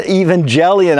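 ordinary talk

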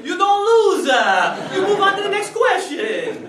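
put-on big loud Italian uncle accent; thick Italian accent. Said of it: "As Uncle Nino would say".

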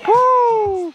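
high, cheering woo; excited whoop. Real, not acted. Celebrating teammate's good play, excited whoop.

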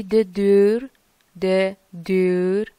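de deur said slowly, the eu drawn out; د دور stretched slow with a long rounded vowel; slow teacher's pronunciation demo; careful Dutch pronunciation. Shown as a demonstration. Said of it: "شاید تلفظش سخت باشه".

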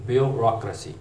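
ब्यूरोक्रेसी as byoo-RAHK-ruh-see; American pronunciation. Shown as a demonstration. Teacher contrasting raw with rah, American byoo-RAHK-ruh-see for ब्यूरोक्रेसी. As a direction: open "rah" stressed in ब्यूरोक्रेसी. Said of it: "जो American pronunciation है".

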